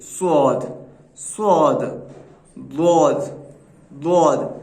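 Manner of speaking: स्वाद and द्वाद said slowly with the tongue curled back; letter sound demo. Shown as a demonstration. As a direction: model स्वाद and द्वाद, each sounded clearly twice. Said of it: "स्वाद और द्वाद का जो तलफ़ुज़ होगा".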